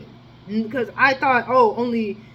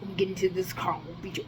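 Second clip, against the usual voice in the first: hushed threat; 3 semitones lower, 6 dB quieter, faster than usual. Retold or imitated. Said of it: "I'm just saying".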